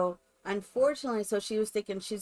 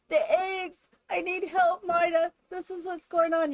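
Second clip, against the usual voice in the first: high, loud, panicky pleading; fake-panic over the eggs, begging for help; freaking out; 10 semitones higher, 5 dB louder than usual. To her friend Mina about the eggs, freaking out. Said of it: "like, freaking out".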